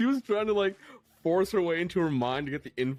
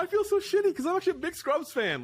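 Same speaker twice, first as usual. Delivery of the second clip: much higher and louder, stunned; shocked disbelief; 4 dB louder than usual. Real, not acted. Co-host hears a Scrubs star voiced a stormtrooper, shocked. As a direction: blurt it out, floored by the news.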